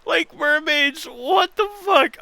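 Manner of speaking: high, strained mock sobbing voice; fake-cry the words. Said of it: "I was trying to do a crying voice".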